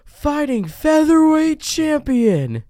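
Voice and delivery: high, slow booming fight announcer; UFC announcer. Pretend. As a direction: boom out the fighter introduction. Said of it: "like a U F C announcer".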